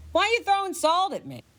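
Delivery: put-on a girl ghost put out at being salted; indignant ghost girl. Ghost girl protests the salt.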